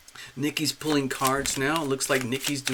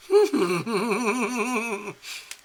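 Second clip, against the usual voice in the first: long high wavering giggle; giddy giggle; 10 semitones higher, 4 dB louder than usual. Real, not acted. Host thrilled by the booking, long giddy giggle. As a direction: giggle helplessly on a high, falling run.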